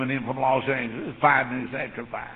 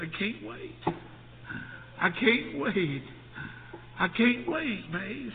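eager wife; 8 semitones higher, slower than usual. Retold or imitated. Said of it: "And she said".

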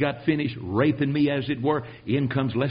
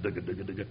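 soft, low mimicked machine chatter; a quiet mechanical dugga-dugga rhythm; teletype clatter; 8 semitones lower, 12 dB quieter, much faster than usual. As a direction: tap it out like an old teletype.

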